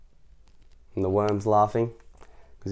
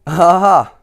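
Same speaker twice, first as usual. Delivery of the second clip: loud, high triumphant ha ha; gleeful cry; 7 semitones higher, 11 dB louder than usual. Acted. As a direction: burst out with a gleeful laugh-cry. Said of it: "cried Mister Tickle".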